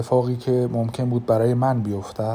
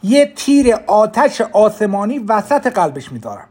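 raised voice; 10 semitones higher, 8 dB louder than usual. Acted. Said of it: "صدایم را بالا بردم تا ونژی بشنود".

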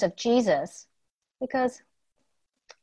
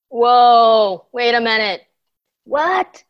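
loud hammed-up astonishment; mock surprise; 7 dB louder, slower than usual. Teacher pretends to be puzzled for the children, loud mock surprise. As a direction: play puzzled for the kids. Storyteller feigns confusion.

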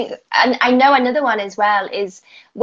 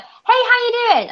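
much higher passing hello; breezy greeting; 14 semitones higher than usual. Retold or imitated. Passing a friend in the street, much higher breezy hello. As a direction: sing it out while walking past. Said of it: "for me to be like".

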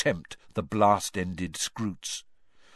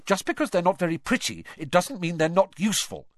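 higher and fast, sharp retort; snapped; 9 semitones higher, faster than usual. A narrator's reading. Hermione defends Hagrid against Malfoy, snapping. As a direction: snap back at him quickly. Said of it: "Hermione snapped".

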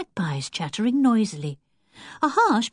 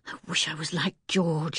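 softer, lower inner musing; private thought; 5 semitones lower, 6 dB quieter than usual. A narrator's reading. Anne thinking to herself about the toad, softly.

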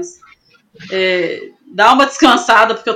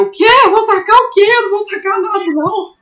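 high-pitched, incredulous retold protest; alarmed refusal; 10 semitones higher, 3 dB louder, faster than usual. Climber protesting to her partner about being told to remove gear, alarmed. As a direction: balk at the task, voice jumping up high.

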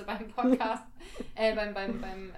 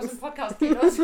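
laughing, louder and higher; 6 semitones higher, 11 dB louder than usual. Real, not acted. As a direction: burst into laughter on the punchline. Punchline, laughing.

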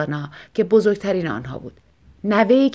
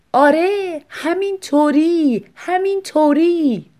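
higher, louder and very slow, mimicking his laugh; mock him by drawing it out; wife mockingly mimicking her husband; mocking imitation; 8 semitones higher, 4 dB louder, much slower than usual. A narrator's reading. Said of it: "از فشار تقلید آن خنده".

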